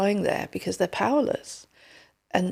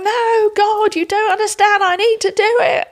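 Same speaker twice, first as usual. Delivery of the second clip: put-on higher, louder, pleading toddler voice; whiny protesting child; 11 semitones higher, 7 dB louder than usual. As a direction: plead with God like a stubborn little kid.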